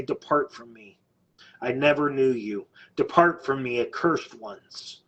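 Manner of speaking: low, grave pronouncement of sentence; slowing on the final words; solemn judgment quote. Retold or imitated. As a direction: pronounce the verdict low, with pauses between phrases. Voicing Jesus on Judgment Day.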